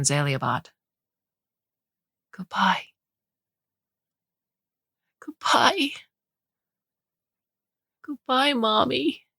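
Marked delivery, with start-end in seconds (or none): 2.3-9.3 tearful goodbye. A narrator's reading. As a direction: say it through tears. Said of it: "Blewett cried".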